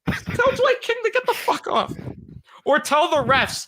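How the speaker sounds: high, loud anguished shouting; emotional outburst. Pretend. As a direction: lose it in a hammed-up yell. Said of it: "I'm gonna get emotional again".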